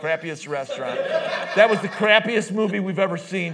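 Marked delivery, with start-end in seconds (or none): 1.5-3.5 laughing. Real, not acted. Joking about the crappy vacation.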